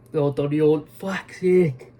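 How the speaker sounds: words pulled out of shape by a yawn. Real, not acted. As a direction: talk through a big yawn. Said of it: "I don't know why it makes me yawn".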